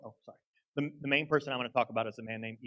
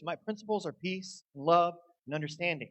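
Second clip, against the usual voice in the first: ordinary talk, nothing marked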